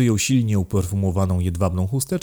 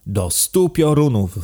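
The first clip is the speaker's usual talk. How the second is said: slow, high exasperated outburst; indignant count exploding, drawn out; shrieked curse; 6 semitones higher, 4 dB louder, slower than usual. A narrator's reading. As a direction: draw out the curse, pitched up. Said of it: "wrzasnął hrabia".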